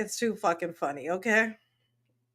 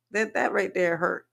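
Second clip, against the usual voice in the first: usual speaking voice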